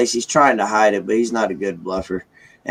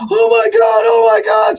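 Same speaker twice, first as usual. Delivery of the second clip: higher, louder imitation of mom breaking down; crying mom; 11 semitones higher, 7 dB louder than usual. Mom reads the good news email, imitated tearful cry. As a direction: imitate mom's voice cracking with joy. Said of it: "I start hearing her voice crack".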